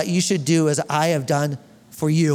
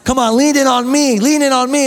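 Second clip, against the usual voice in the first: pleading call; 4 semitones higher, 5 dB louder than usual. Acted. Pastor voicing Jesus inviting.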